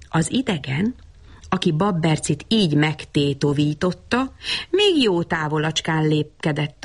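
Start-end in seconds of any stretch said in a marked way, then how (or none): none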